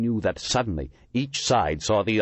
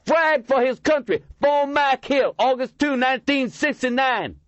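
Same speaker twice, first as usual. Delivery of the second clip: high, loud bitter shouting; skinny homeless man shouting his brother's death; angry outcry; 4 dB louder than usual. A narrator's reading. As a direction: hurl the date at them. Grieving veteran shouting at crowd.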